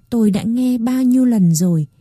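plain reading voice

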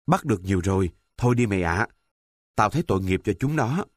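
ordinary delivery, nothing marked

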